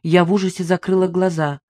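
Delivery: ordinary delivery, nothing marked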